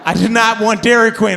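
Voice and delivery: loud, high comic protest; half-shouted exasperation; exasperated outburst. Retold or imitated. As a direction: burst out in frustration. Exasperated replay of his feeling.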